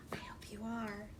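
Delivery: soft secret whisper. Acted. Storyteller whispering to young listeners.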